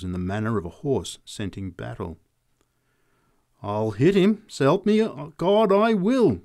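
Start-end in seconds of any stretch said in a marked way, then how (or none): 3.6-6.4 rising into a high, loud threat; threatening big woman. A narrator's reading. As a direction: build to a forceful vow. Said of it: "she informed us".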